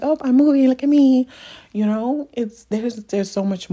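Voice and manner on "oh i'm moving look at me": high, loud bragging singsong; mock someone showing off their big move; hammed-up look-at-me brag. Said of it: "It's not just like".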